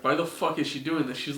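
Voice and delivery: ordinary talk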